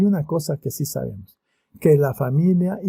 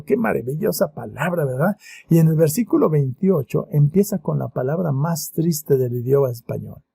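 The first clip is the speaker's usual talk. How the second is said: ordinary delivery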